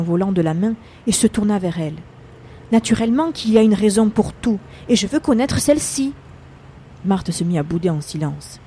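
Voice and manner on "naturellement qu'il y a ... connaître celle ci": louder, higher, indignant; angry retort. Acted. Charles, having hit the wheel, answers his wife angrily. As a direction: snap back at her, voice rising.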